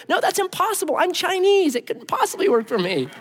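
high, disbelieving protest; protesting disbelief. Pretend. New heir protesting to the palace, high and disbelieving. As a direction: refuse to believe it, playfully.

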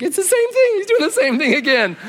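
very high, squealed disbelief; hammed-up exclamation in a squeaky pitch; mock alarm. Companion seeing the picture trick repeated, squealing alarm.

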